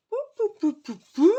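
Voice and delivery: high bursts of excitement; sing-song excited pou-pou. Real, not acted. Excited sound over the kit.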